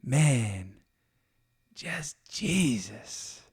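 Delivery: very slow, awed "Man, just Jesus"; drawn out in awe. Shown as a demonstration. Showing listeners one way to say "just Jesus", slowly awed. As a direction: stretch "just Jesus" way out, full of wonder. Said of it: "three amazing ways".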